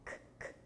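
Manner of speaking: K, K as voiceless clicks with no vowel; teacher isolating K; isolated K sound. Shown as a demonstration. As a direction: push out the bare K sound. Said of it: "no som de K".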